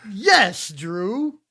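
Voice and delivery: hype self-talk. Retold or imitated. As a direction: hype yourself up in the mirror. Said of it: "And I was like".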